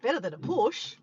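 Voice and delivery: ordinary delivery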